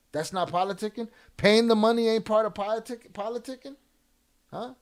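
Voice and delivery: high-pitched indignant question; incredulous challenge. Real, not acted. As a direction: challenge them with rising disbelief. Host challenges chatter in disbelief.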